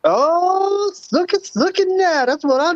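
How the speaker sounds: light up at what you see; high thrilled oh, look at that; delighted surprise. Real, not acted. Sees giraffe on video.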